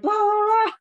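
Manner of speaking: blah blah blah thrown out high and loud; overexcited babble. Retold or imitated. Mocking her own overexcited sales chatter, high and loud. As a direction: gush like an overeager seller. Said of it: "Sometimes we get so excited".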